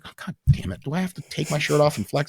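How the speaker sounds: high, put-upon exasperation; mock fed-up complaint; exasperated. Lifter to a drunk guy, a high exasperated mock complaint.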